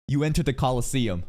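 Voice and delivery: ordinary delivery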